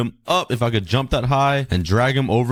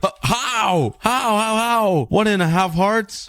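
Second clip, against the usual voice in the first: disbelief; 10 semitones higher, slower than usual. Real, not acted. Survived a fall, disbelief.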